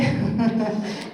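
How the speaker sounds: a quick laugh; a quick giggle at her own joke; short laugh. Real, not acted. Trainer laughing at her joke about dying, a quick laugh.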